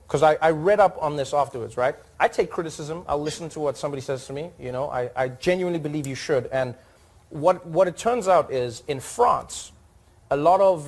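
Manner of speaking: normal talking voice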